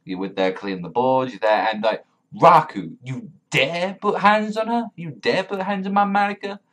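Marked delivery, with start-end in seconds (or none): 2.3-6.6 indignant challenge. Acted. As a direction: confront him in mock outrage, pitched way up. Indignantly challenging the character Raku.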